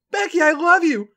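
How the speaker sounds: high, loud declaration; shouted. A narrator's reading. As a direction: shout it at the top of the lungs. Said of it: "at the top of her lungs".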